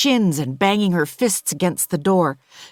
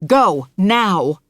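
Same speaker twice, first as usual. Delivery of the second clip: loud urgent command; nanny shouting at the mother to flee; 2 semitones higher, 5 dB louder than usual. Acted. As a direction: order her off, fast. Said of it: "I shout".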